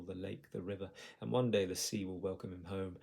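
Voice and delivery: usual speaking voice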